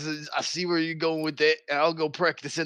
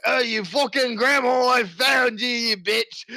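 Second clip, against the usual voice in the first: higher, louder hammed-up shout; playful furious shout; mock battle shout; 7 semitones higher, 4 dB louder, slower than usual. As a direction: yell it like charging at a foe. Character charging enemy.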